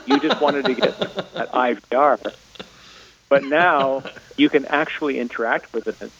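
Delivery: ordinary talk, nothing marked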